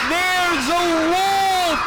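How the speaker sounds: high, drawn-out warning yell; sustained high shout to summon help; screaming alarm. Acted. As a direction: holler it slowly from a mountaintop. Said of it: "he screams at the top of his lungs".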